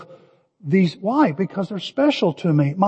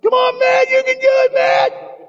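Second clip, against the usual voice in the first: cheering shout. Retold or imitated. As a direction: cheer him on with everything. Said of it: "my eyes go up".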